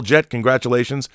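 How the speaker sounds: ordinary delivery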